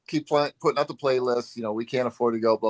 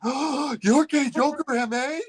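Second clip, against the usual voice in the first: much higher mock-amazed squeal; starstruck gasp; 4 dB louder than usual. Woman learning his screen name, high and amazed. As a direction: gush like a starstruck fan. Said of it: "she's like, gasps".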